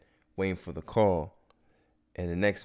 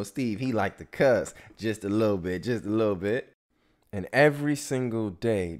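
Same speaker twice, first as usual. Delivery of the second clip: ordinary talk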